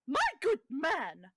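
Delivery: loud, high indignant outburst; shrieking hostess. Acted. Indignant hostess to the man she invited in, shrieking. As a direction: shriek in outrage at the guest. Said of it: "You can hear her shrieking".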